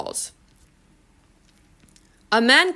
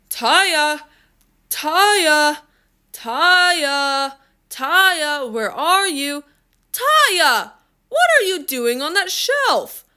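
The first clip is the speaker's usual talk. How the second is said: raised, drawn-out searching call; 5 semitones higher, much slower than usual. A narrator's reading. Father looking for his lost daughter, calling out. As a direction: call the name out, climbing to high astonishment.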